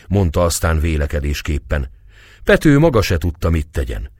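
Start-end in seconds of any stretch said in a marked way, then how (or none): none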